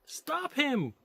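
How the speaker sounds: high call from behind. Acted. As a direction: call for help across the street. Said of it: "called a female voice from behind".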